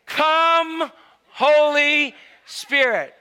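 very slow, high, loud summons; drawn-out invocation. Acted. Evangelist doing it again, drawn-out booming invocation. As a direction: stretch each word into a grand call.

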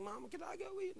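put-on high, soft childlike plea; little donkey. Young donkey asking his mom for food, high and small. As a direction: ask like a small kid.

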